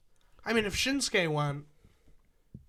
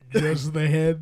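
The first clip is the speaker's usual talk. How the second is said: George Takei impression; 3 semitones lower, 6 dB louder, slower than usual. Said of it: "a great George Takei".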